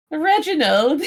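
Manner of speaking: high, grand call to the butler; imperious plummy grande dame. Pretend. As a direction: summon the servant grandly. Calling the butler.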